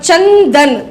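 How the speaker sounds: चंदन slowly, with a held dental n, then दन; slow two-part teaching demo of चंदन; n before द. Shown as a demonstration. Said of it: "द से पहले है तो न आएगा".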